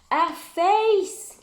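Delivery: high, loud gasp of a reveal; spring the surprise on them; surprised exclaim. Acted. Teacher revealing the Yeti to the children, high loud surprise.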